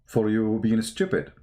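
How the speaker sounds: normal talking voice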